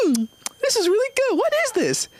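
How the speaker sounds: little sister voice. Retold or imitated. Comic voicing the little sister tasting soup, high and sweet. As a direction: squeak it out like a delighted little girl. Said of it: "my little sister, she's like".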